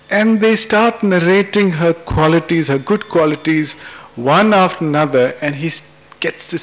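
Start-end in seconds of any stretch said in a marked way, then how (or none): none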